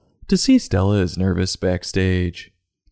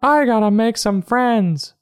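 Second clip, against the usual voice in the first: high-pitched cartoon dog voice; squeaky put-on character voice; Stella. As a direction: pitch it way up, talking to yourself. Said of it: "Stella says to herself".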